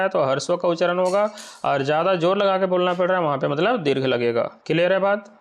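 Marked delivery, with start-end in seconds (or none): none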